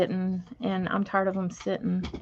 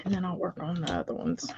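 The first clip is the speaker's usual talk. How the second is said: whispering; 3 semitones lower, 7 dB quieter than usual. Real, not acted. Whispering to herself while crafting.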